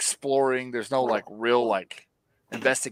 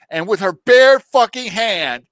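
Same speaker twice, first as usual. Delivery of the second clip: louder, slower, punching each word; outraged emphasis; 3 semitones higher, 8 dB louder, slower than usual. Real, not acted. Retelling Galadriel punching ice, loud and slow. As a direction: hit it hard in disbelief.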